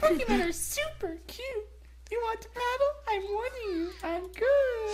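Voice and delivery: put-on very high girly voice; Whitney girl voice. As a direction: squeak it out like a sweet young girl. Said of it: "[name], do your girl voice".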